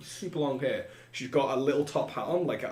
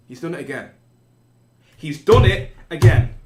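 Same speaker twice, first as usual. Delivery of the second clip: angry woman; 4 semitones higher, 15 dB louder than usual. Acted. Union woman shouting at Ulf across the table, building anger. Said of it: "she goes".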